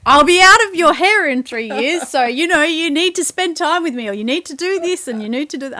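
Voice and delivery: high, loud teenage daughter impression. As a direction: act out a pushy teen daughter, pitched high. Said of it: "So she's like".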